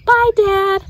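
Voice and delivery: high and loud goodbye; calling out. Acted. Girl calling goodbye to her dad, high and loud. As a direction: call it out to Dad from the car.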